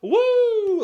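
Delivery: high loud crowd cheer; audience whoop. Retold or imitated. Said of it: "you can hear almost the audience like".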